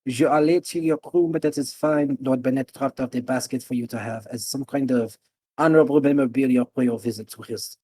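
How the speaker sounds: Mancho Cheris. Acted. Innkeeper welcoming late guests back, French accent. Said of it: "kinda like a French accent".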